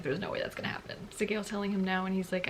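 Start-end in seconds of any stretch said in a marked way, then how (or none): 0.0-1.0 quiet. Retold or imitated. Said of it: "Zayden's like".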